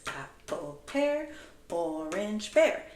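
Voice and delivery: four words chanted one per beat with bouncing pitch; rhythmic chant for the class; modelling the rhythm. Shown as a demonstration. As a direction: chant "Apple, pear, orange, bear" in even beats. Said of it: "let's say this with words".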